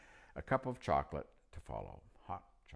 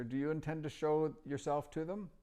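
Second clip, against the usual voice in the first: put-on old magician; 11 semitones higher than usual. A narrator's reading. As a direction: pitch up into the chatty old magician. Narrator voicing old magician.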